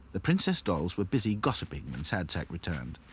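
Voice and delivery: ordinary delivery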